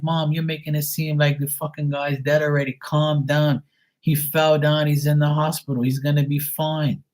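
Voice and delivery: high-pitched exasperated retort; worked-up, impatient reassurance; exasperated son. Retold or imitated. Exasperated son calming dramatic mom.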